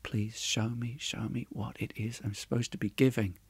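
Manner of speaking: hushed plea, well under normal volume; silent prayer. A narrator's reading. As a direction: pray it quietly, almost to yourself. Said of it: "with the prayer on his lips".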